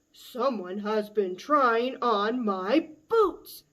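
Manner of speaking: loud, slow booming Papa Bear; booming big bear voice. Acted. Papa Bear finds his boots moved, slow loud boom. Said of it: "he boomed in his big bear voice".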